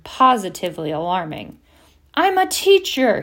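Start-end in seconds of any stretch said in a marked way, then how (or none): 2.2-3.2 much higher, loud outraged roar; angry roar. A narrator's reading. Hagrid rebuking Harry at the door, roaring. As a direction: roar it in indignation. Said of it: "he roared at Harry".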